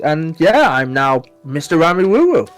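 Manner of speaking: usual speaking voice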